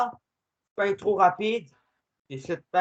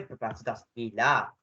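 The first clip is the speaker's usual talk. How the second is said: ordinary talk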